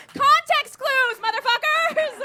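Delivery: high, drawn-out comic shout; loud, high-pitched mock outrage; exasperated yell. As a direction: blast it out slowly in frustration. Exasperated outburst at French people.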